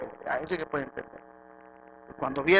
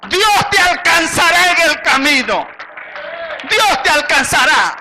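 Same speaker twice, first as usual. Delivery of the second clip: grito de predicador, alto y lento; proclama a gritos; 10 semitones higher, 11 dB louder, slower than usual. Acted. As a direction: lanza cada palabra con toda la fuerza, alargándola. Predicador proclama palabras con fuerza. (prédica a gritos, lenta y aguda)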